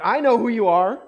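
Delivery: a possessed man crying out in the synagogue; demon's loud cry. Acted. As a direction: shout it high and loud at Jesus. Voicing the demon confronting Jesus.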